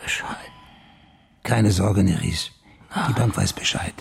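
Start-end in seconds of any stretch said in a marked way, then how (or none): 0.0-0.5 faint whispered echo. Acted. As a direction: repeat the word in a breath. Lauscht dem Patienten, flüstert nach. (kaum hörbar geflüstert)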